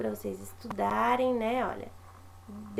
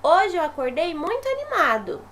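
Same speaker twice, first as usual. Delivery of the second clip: a touch of excitement, somewhat louder; lightly voiced excited boy; 3 semitones higher, 6 dB louder than usual. Shown as a demonstration. Teacher voicing little Joãozinho's happy line, mildly excited. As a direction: read the little boy's line with mild enthusiasm. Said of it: "que é a fala do Joãozinho".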